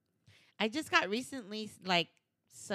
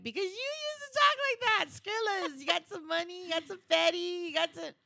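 high, loud mocking comeback; high playful jab; mock teasing voice; 12 semitones higher, 6 dB louder than usual. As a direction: tease him with his old slang. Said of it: "I'm like".

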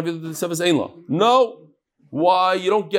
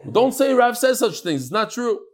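higher-pitched mock scolding; sarcastic rebuke; 6 semitones higher than usual. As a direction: hush them with acted, sarcastic disapproval. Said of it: "Yeah, I was being sarcastic".

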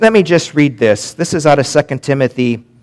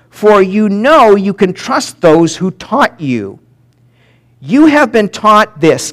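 slow emphatic scripture reading; 5 semitones higher, slower than usual. Acted. Reads scripture to congregation.

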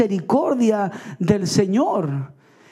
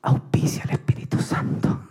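mock whisper; 4 dB quieter than usual. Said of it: "Y yo le digo".

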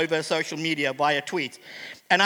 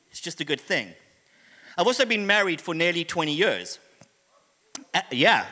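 ordinary delivery

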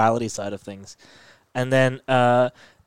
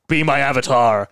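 mock-imposing god voice; commanding god; 4 dB louder than usual. Khonshu demanding a new avatar, mock-commanding. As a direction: put on a grand, commanding deity. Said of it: "Khonshu's like".

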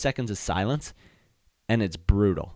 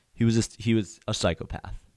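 normal talking voice

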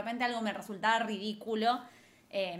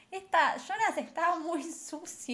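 higher, slow mock disgust; 8 semitones higher than usual. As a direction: play up the disgust, slowly. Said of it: "Era como".

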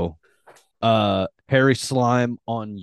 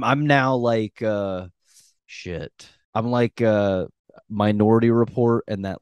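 usual speaking voice

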